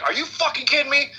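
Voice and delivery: high, indignant demand; hammed-up outraged sergeant; angry disbelief. Hard-ass first sergeant to young journalist, outraged.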